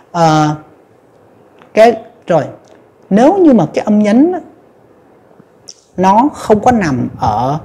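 normal talking voice

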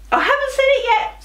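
high, fast mock outrage; playful high squeal at the kids; squeaky high. Shown as a demonstration. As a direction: squeal it in pretend shock.

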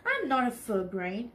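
ordinary delivery

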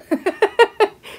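sudden high burst of laughter; shouted laughter. A narrator's reading. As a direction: explode into helpless laughing. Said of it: "suddenly shouted with laughter".

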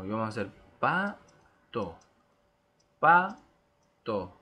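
pato split into two loud beats; syllable rhythm. Shown as a demonstration. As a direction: stamp out pato slowly. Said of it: "hacia arriba y hacia abajo".

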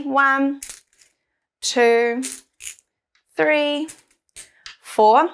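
put-on counting along with a child; teaching a child to count. Shown as a demonstration. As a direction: count aloud for kids to join. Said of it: "So count with me".

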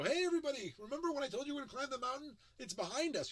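high, loud, fast announcement; spring the surprise on the crowd; triumphant reveal. Retold or imitated. Presenter revealing to the audience the climb is done, high and loud. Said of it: "afterwards I go".

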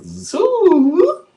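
sudden high, loud whoop; a wild excited holler. Acted. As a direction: shoot the voice way up and yell it. Excited whoop about getting high.

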